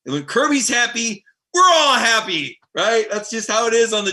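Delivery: building happy excitement; excited. Real, not acted. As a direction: get carried away with joy.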